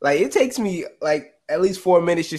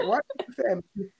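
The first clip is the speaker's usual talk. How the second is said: higher, softer mimicry of a timid man; meek; 4 semitones higher, 6 dB quieter than usual. Showing how the man in the video says it, meek. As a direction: gently tut in a small imitated voice. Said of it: "He says it so meekly".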